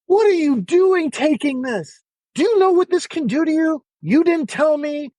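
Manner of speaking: shrill, slowed outrage from an angry doctor; retold screaming doctor. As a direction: mimic the psychiatrist screaming at you. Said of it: "this guy's screaming at me".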